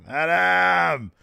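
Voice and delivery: high, loud shout of the name; mock wailing call. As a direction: yell it as a hammed-up plea. Imitates wrestler calling out again.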